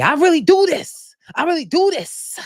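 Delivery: high, loud mock of Brittany; mock Brittany outburst. Vlogger mimicking Brittany's fight outburst, hammed-up high voice. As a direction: mimic her yelling it, hammed-up.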